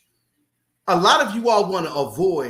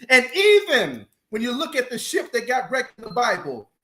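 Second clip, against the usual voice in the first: preaching; 6 semitones higher, 3 dB louder than usual. Acted. Preaches to listeners.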